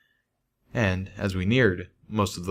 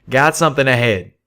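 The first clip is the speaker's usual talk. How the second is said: louder, higher call from above; 4 semitones higher, 6 dB louder than usual. A narrator's reading. Calamity calling down from the rock, louder. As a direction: call down a warning to friends.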